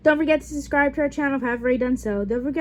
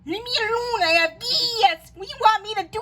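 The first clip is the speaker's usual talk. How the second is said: whining; 8 semitones higher than usual. Acted. Whiny complaint about bathroom.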